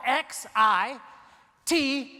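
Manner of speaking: slow letter-by-letter spelling. Shown as a demonstration. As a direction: spell each letter out slowly. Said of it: "how do you actually spell".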